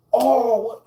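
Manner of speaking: high, loud startled oh; surprised oh. Retold or imitated. The narrator spotting the big homie, a startled loud oh. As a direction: jolt up on it in surprise.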